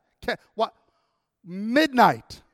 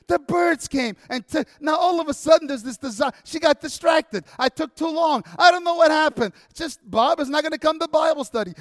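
higher, louder exasperated preaching; frustrated shout; 7 semitones higher, 6 dB louder than usual. Real, not acted. Preacher venting frustration to the congregation, loud exasperated shout.

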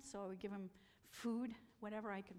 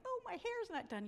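flustered lady; 11 semitones higher than usual. Retold or imitated. Lady flustered at being photographed.

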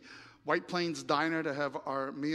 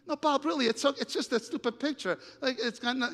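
pleading; 8 semitones higher than usual. Retold or imitated. Pleading over the stupid picture.

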